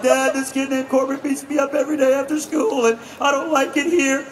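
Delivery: lightly voiced upset sixth grader; whiny kid voice. Boy telling his father about the bully, a touch whiny. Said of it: "And I was like".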